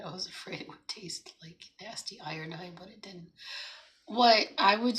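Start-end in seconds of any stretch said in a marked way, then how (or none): 0.0-3.3 hushed confiding aside; whispering. Real, not acted. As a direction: drop to a whisper. Aside about iodine taste.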